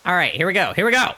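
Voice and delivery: rally the viewers for the big one; excited hype. Real, not acted. Streamer hyping viewers for egg eight sixty-nine, excited.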